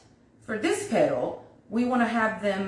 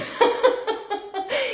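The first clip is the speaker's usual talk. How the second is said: long, high-pitched cackle of amusement; real laugh; 5 dB louder than usual. Real, not acted. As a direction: burst out laughing, high and loud. Amused by the mummy comment.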